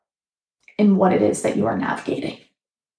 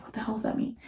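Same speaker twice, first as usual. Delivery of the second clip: whispered aside; 13 dB quieter, faster than usual. Retold or imitated. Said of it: "sometimes I'm like".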